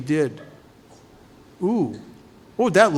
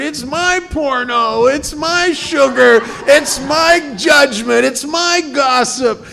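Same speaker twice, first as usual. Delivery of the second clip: put-on high, loud, slow whiny child clinging to her candy; stubborn little girl; 10 semitones higher, 6 dB louder, slower than usual. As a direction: play a defiant child refusing, high and loud. Girl refuses to let go.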